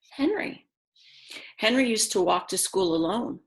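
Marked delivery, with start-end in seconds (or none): 0.1-0.7 high whispered name; whispered title. Acted.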